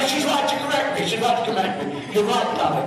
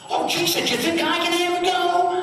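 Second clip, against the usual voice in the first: American impression; 8 semitones higher than usual. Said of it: "'cause he was American".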